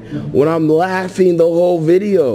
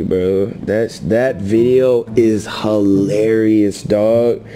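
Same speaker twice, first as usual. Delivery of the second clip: much lower, dopey parody of the rival; mock deep rival voice; 9 semitones lower than usual. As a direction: drop way low to mock him.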